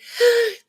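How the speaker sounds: sharp high gasp of delight; excited gasp. Real, not acted. Excited about gong.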